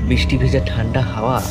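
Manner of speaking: ordinary delivery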